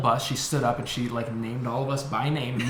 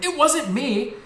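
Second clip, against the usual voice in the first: high-pitched retold kid's denial; mock protest; 13 semitones higher, slower than usual. Blamed kid to the teacher, high mock protest. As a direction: protest as the wrongly blamed child.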